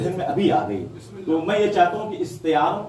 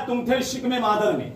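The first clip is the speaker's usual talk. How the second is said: impassioned preaching; 9 semitones higher than usual. Acted. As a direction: lift it to the crowd, building fervour. Rising sermon delivery of Imam's answer. (امام کے جواب کو جوش سے سنانا)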